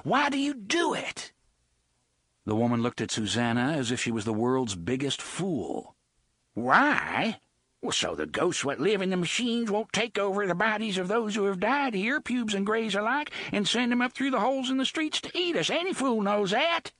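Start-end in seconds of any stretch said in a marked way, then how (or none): 0.0-1.3 high, harsh horrified cry; shocked and appalled. Acted. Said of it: "in a harsh, horrified voice". 6.5-16.9 high, scornful coarse woman's voice; scornful rough woman. Acted. Bloody woman explains scornfully.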